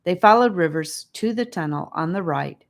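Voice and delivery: ordinary delivery, nothing marked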